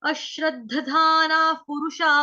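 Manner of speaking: slow, drawn-out अश्रद्धधाना पुरुषा with long aa vowels; pronunciation demo. Shown as a demonstration. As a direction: chant अश्रद्धधाना पुरुषा syllable by syllable, slowly. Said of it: "अब हम इसका देखेंगे उच्चारण".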